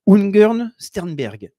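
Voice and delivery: slow, careful attempt at saying "Ungern Sternberg"; tentative pronunciation. Real, not acted. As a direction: try the foreign name "Ungern Sternberg" slowly, unsure of it. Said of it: "je ne sais pas comment on le prononce".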